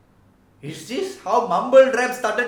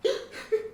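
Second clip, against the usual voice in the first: high, breathy sob gasp before the wail; sharp crying gasp; 12 semitones higher, 6 dB quieter than usual. Retold or imitated. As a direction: gasp as if about to burst into tears. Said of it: "And then she be like".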